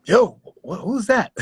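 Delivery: imitated gasp, then high-pitched startled question; astonished reaction. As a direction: mimic a crowd stunned by what it hears. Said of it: "I saw everybody go".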